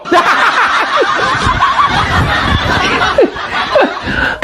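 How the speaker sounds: extended breathy high laughter; long high laugh. Real, not acted. Host mocking the simple answer, long laugh. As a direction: laugh hard in disbelief.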